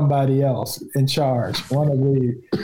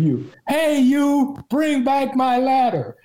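high-pitched calling out after a thief; mock yell, pitched way up; retold yell; 10 semitones higher, slower than usual. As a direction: holler it up high, as if across a yard. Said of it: "I yelled at him".

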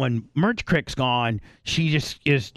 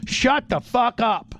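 higher, slow, drawn-out mock yell; retold yell at the dog; 9 semitones higher, slower than usual. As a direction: snap at the dog, stretching each word. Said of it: "I just yell at her".

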